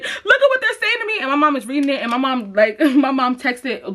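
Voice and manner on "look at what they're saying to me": put-on upset kid. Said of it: "I was just like".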